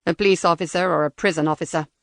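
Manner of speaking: cold robotic interrogator. Acted.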